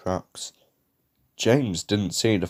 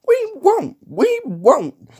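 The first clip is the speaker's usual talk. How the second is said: very high, loud squealed refusal; screaming; 6 dB louder, slower than usual. A narrator's reading. Trucks refusing James, screaming. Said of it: "screamed the trucks".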